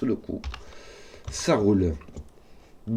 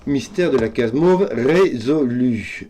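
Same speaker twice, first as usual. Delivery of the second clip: slow, loud announcement of victory; hammed-up declaration; mock triumphant; 3 semitones higher, 8 dB louder, slower than usual. Real, not acted. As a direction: proclaim it like a grand reveal. Announcing solved puzzle section.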